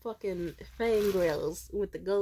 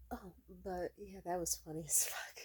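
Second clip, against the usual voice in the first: whisper; 9 dB quieter than usual. Real, not acted. Late-night whispered aside.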